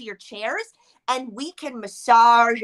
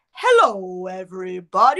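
low, full voice from the belly; deep diaphragm voice; 8 semitones lower, 4 dB louder than usual. Shown as a demonstration. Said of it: "let's get in our deep diaphragm voices here".

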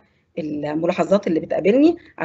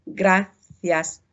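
"جراسياس" drawn out slowly in two parts; teacher's slow model of "جراسياس", hard g; slow syllable demo; much slower than usual. Shown as a demonstration. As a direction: break "جراسياس" slowly after a hard-g "gra". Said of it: "فاتنطق الجيم بقى جرا".

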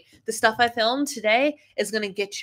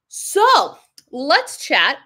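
drawn-out invite; 5 semitones higher, 7 dB louder, much slower than usual. Real, not acted. Invites viewers to chat.

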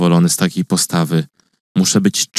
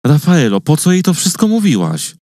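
agitated reproach; 8 semitones higher than usual. A narrator's reading. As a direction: confront her, stirred up and indignant. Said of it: "bo byłem silnie wzburzony".

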